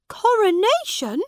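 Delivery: high, loud startled question; spring the word back in disbelief; surprised. A narrator's reading. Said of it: "said Edward, surprised".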